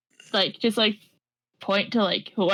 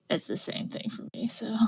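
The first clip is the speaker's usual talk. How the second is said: unvoiced whispered aside; whispering; 12 dB quieter than usual. Real, not acted. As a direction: whisper it under your breath. Aside while rolling dice.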